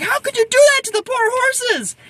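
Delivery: very high, wailing mock indignation; mock outrage. Cashier upset for the poor horses, mock outraged squeal. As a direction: imitate someone squealing in dismay.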